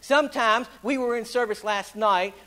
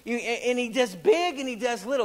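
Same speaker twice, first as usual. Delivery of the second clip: raised, rhythmic pulpit preaching; full-force revival preaching; 4 semitones higher, 6 dB louder than usual. Acted. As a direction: preach it out to the congregation. Preacher in full sermon delivery.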